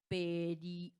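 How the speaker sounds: "Perio" drawn out, ending on a long stressed O. Shown as a demonstration. Teacher asking where stress falls, "Perio" drawn out to a long O. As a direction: stretch "Perio" slowly and lean on the final O. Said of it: "En la O".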